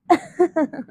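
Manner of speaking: a brief acted laugh; short laugh. A narrator's reading. Character about to agree to a request, short laugh. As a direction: let out a quick little laugh.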